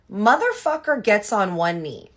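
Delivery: high, loud, slowed-down reveal; outraged disbelief. Real, not acted. Telling viewers her ex knelt to propose, outraged disbelief. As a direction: land each word, appalled and amazed.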